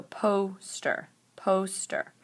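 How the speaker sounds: poster split slowly into po and ster; broken into chunks. Shown as a demonstration. Teacher showing the syllable juncture, poster broken in two. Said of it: "break the word into chunks".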